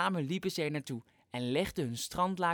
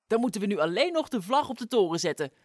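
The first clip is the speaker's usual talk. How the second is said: fast, eager and higher; enthusiastic; 4 semitones higher, 6 dB louder, faster than usual. Acted. Flop eagerly tells Sas to put the flag on top, fast and excited. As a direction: rush it out with excitement. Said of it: "zei Flop enthousiast".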